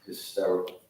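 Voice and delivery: normal talking voice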